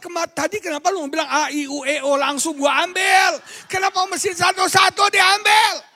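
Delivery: high, loud retold protest building to a shout; retold exasperated outburst; exasperated complaint. Exasperated husband retelling his complaint.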